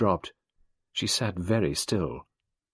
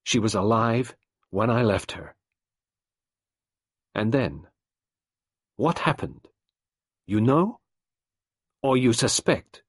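raised, urgent questioning; detective and wife, voices lightly raised; tense exchange; 4 semitones higher, 5 dB louder than usual. A narrator's reading. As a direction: press her for the truth. Nadine answers, Poirot probes.

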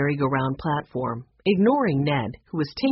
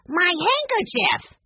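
high, slow, stretched cry; yelled; 13 semitones higher, slower than usual. A narrator's reading. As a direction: call after the flying handkerchief. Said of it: "Benny yelled".

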